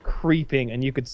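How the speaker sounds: normal talking voice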